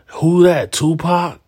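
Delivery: high, loud fake-innocent question; a trolling friend playing dumb; mock clueless troll. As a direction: play dumb loudly to bait him. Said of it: "hear somebody playing music and go".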